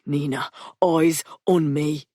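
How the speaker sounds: slow, steady command; steady firm voice. Acted. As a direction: hold her gaze with your voice. Patrick voice.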